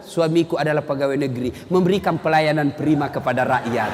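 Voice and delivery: talking herself into trust; calm trusting wife. Acted. Istri berprasangka baik. (istri yang tenang membela suaminya)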